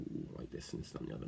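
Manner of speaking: breathy mocking whisper. Imitating a mate sneering at the record, whispered. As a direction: whisper it like the friend pulling faces. Mimics mate pulling funny faces.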